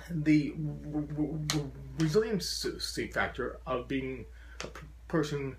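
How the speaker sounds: repeated blocked syllables; stammering on the r sound; stutter. Real, not acted. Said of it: "to hear it throughout this entire speech".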